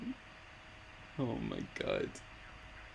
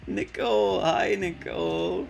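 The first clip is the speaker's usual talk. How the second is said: excited; 7 semitones higher, 7 dB louder than usual. Real, not acted. Greeting a viewer excitedly.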